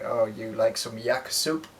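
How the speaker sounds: mock a cartoon Russian offering yak soup; bad Russian accent. Describing Abby's movie voice in a bad Russian accent. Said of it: "A bad Russian accent".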